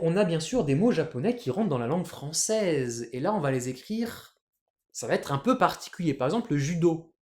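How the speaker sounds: ordinary talk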